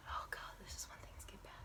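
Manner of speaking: hushed, quick dread; whispered aside. Real, not acted. Storyteller confiding that trouble is coming, whispered. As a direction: whisper it fast to yourself.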